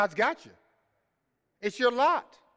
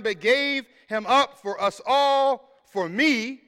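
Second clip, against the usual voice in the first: louder, rising, emphatic reading; louder, measured pulpit reading; scripture preaching; 2 semitones higher, 5 dB louder than usual. Acted. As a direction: proclaim the verses with force.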